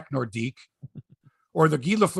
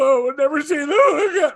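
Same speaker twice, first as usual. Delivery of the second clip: mock wail; 9 semitones higher, 6 dB louder than usual. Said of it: "Like, I didn't go".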